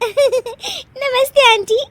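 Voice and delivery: high, slow hello through laughter; giggling greeting. Acted. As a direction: greet the guests while suppressing giggles. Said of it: "Anshu हंसने वाली दवा खा लेती है". (हँसी दबाते हुए नमस्ते)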